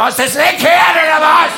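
high, loud barking voice; a voice in his head shouting at him; hammed-up angry inner voice; mock yell. Voice in head snaps.

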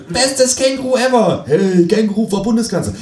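high, excited fan voice; high mock-fan comment voice; gushing fan. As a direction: gush like an online fan.

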